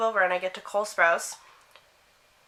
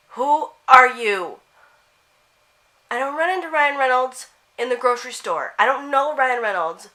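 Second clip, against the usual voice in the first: higher and louder, stretched out in disbelief; incredulous outburst; 5 semitones higher, 7 dB louder than usual. Retold or imitated. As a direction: act scandalized at the absurd assumption. Outraged reply to the girl's assumption.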